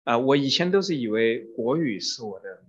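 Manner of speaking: normal talking voice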